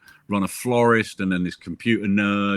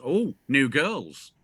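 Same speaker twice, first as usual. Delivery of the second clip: higher, very drawn-out parrot; 4 semitones higher, much slower than usual. Acted. Brothel parrot eyeing the two daughters, parrot voice. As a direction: stretch it out slowly like a talking parrot. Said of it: "the parrot looks at them and goes".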